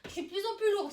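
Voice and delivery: winded mid-workout; puffing through the effort; out of breath. Real, not acted. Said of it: "j'ai l'impression".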